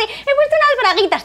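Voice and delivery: put-on very high, sugary baby talk. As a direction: coo it like a little girl. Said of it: "hablando con voz de bebé".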